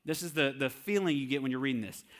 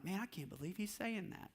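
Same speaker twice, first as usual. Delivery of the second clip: doubting listener; 4 semitones higher, 12 dB quieter than usual. Retold or imitated.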